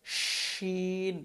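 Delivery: শিন very slow, long sh and held vowel; spreading sh. Shown as a demonstration. Teacher modelling letter shin, sound spreading wide. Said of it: "শিনের উচ্চারণটা হবে আওয়াজ ছড়াবে".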